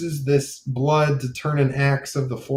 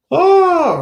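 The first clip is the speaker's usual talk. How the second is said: sharp high startled "Oh"; sudden realisation; 9 dB louder than usual. Real, not acted. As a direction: it just hit you, blurt it out. Sudden exclamation breaking off narration.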